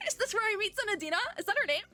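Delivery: high, fast excited questions; excited gasp. Real, not acted. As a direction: rush it high like spotting a favourite. Recognizing a character excitedly.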